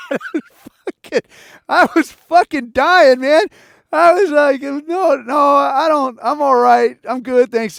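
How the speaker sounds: high, loud, laughing through the words; cracking up. Real, not acted. Man recalling a stranger's offer, cracking up. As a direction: squeal it out, barely holding it together.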